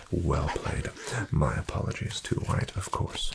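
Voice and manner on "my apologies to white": usual speaking voice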